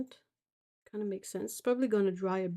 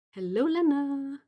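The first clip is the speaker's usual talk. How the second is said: sing-song; 6 semitones higher, 5 dB louder than usual. Real, not acted.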